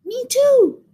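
high little mouse voice, mock whisper; tiny high mouse, hushed in pretence. A narrator's reading. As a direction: squeak it small, as if hushed. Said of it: "whispered Mouse".

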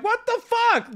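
very high-pitched mock outrage; joking protest. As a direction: squeal it in playful disbelief. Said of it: "I'm smiling and joking through it".

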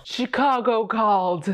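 slow, mock dramatic echo of the show's line. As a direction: repeat it with hammed-up gravity. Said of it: "Who says that?".

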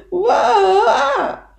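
long, high "waa" cry; loud bawling. Acted. Lonely little beaver crying by the lake, loud high wail. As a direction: bawl it out high like a small animal. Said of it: "huilde Kleine bever".

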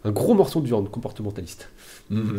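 normal talking voice

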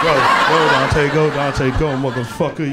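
loud shouted chant; shouted cheer. Retold or imitated. Comic cheerleader shouting at Dante, loud spiteful cheer. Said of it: "I'd be like".

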